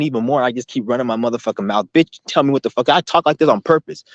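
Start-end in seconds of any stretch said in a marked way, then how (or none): none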